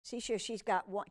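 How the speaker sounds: plain reading voice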